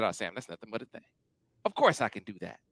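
imitating Al. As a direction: play a low, unbothered yes. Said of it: "Al said".

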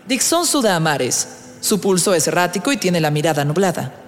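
ordinary talk, nothing marked

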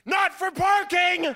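high, loud, slow zinger; deadpan shout. Retold or imitated. Mom topping her son's defense of dad, loud high zinger. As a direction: fire it back instantly, loud and high. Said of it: "without missing a beat, she just goes".

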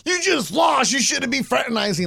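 mock outrage; mock scolding. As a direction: play the outraged critic. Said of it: "people are like".

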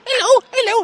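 put-on high squeaky baby-talk hello. Shown as a demonstration. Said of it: "just say hello in a squeaky voice".

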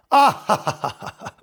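forced, measured ha-ha laugh; put-on slow fake laugh trailing away; demonstrated calm laugh. Shown as a demonstration. Showing how a man laughs when insulted, deliberately calm laugh. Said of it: "very calmly".

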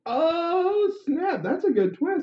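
high, drawn-out delighted shock; amazed reaction. Real, not acted. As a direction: squeal at a great plot twist. Listener reacts to twist ending.

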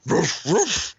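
loud, high imitated barks; two sudden rapper-style woofs. Co-host still riffing on the rapper, barking. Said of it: "keep thinking D M X".

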